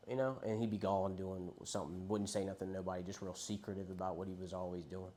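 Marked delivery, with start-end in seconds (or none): none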